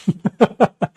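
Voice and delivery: a short real laugh at the joke; amused laugh. Real, not acted. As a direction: laugh at your own bad drawing. Laughs at own drawing. (हल्की हँसी)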